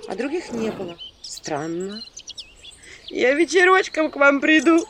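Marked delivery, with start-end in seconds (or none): 3.1-4.8 high, loud village woman's sigh; loud sighing plea; sighing promise. A narrator's reading. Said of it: "вздохнул голос".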